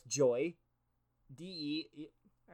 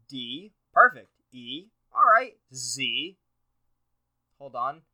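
ordinary delivery

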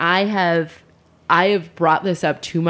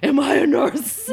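mock alarmed question; 9 semitones higher than usual. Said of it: "And then you're like".

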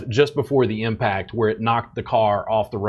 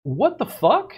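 shrill high disbelieving outburst, much higher; mock outrage; 13 semitones higher than usual. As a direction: squeal it like you can't believe your ears. Retelling outraged reaction.

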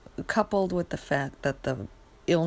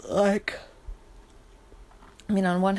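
talking through a yawn; words stretched by a yawn; 4 semitones higher than usual. Real, not acted. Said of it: "I'm so sorry I'm yawning".

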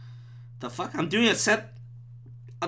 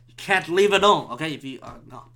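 normal talking voice

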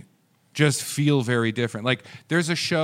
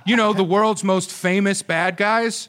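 high, loud, drawn-out delivery with laughter; slow, punchy comedian's punchline, pitched up; Norm Macdonald; 7 semitones higher, 5 dB louder than usual. Acted. Host joking about the war's losers, comic Norm Macdonald-like voice. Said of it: "I'm turning into Norm Macdonald".

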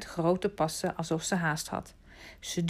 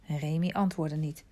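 usual speaking voice

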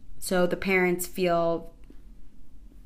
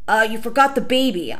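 pushy parent; 3 semitones higher, 8 dB louder than usual. Retold or imitated. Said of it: "they're like".

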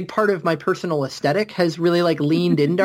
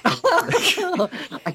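laughing; 11 semitones higher than usual. Real, not acted.

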